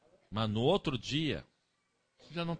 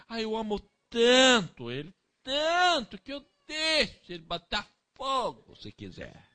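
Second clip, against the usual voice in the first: high, slow, doting mock parent voice; permissive parent; 10 semitones higher, 7 dB louder than usual. Preacher mocking a parent who never corrects, high doting voice. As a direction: ham up a soppy indulgent parent.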